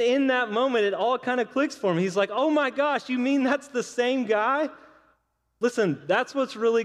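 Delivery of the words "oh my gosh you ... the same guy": high, amazed "oh my gosh" with a rising question; realising the ball's owner all at once; sudden realisation. Retold or imitated. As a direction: kid stunned by the news, voice up high. Smalls realises Ruth is Babe Ruth.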